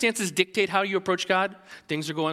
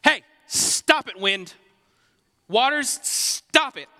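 higher, louder, slow command to the storm; Jesus rebuking the wind and waves, loud and slow; firm rebuke; 6 semitones higher, 4 dB louder, much slower than usual. Pretend. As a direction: scold the wind like a tired parent. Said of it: "he's just like".

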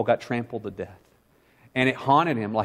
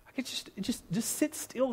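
whisper; 11 semitones higher, 11 dB quieter than usual. Real, not acted.

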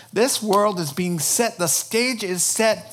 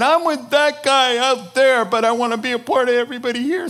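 much higher, louder, rushed whine; mock whiny plea; 8 semitones higher, 7 dB louder, faster than usual. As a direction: ham up a needy people-pleaser.

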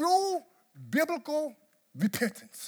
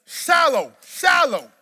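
louder, mock self-scolding; shallow; 4 semitones higher, 10 dB louder, slower than usual. Real, not acted. Pastor to congregation, loudly mocking his own prayer. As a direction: call it out loud. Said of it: "I know it is".

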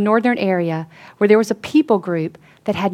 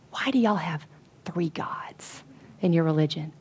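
hushed softer question; whispered; 3 semitones lower, 11 dB quieter than usual. Retold or imitated. Student asking in the mosque, whispering. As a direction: whisper it so no one in the mosque hears.

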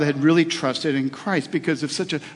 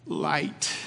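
a weak, raspy, softer word; 7 dB quieter than usual. Retold or imitated. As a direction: rasp it out like a frail sick father. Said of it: "with a raspy voice".

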